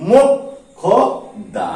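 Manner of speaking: মোক্ষদা very slowly as mok, kho, da; syllable-by-syllable pronunciation. Shown as a demonstration. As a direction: break মোক্ষদা into three parts with long pauses. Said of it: "তাহলে উচ্চারণ কি করছি?".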